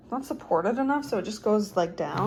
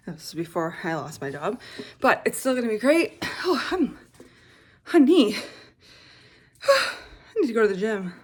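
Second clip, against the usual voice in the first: ordinary talk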